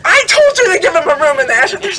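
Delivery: high, loud mock scolding; yelling reprimand. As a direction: scold an aide as the guru would. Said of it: "at this he yells".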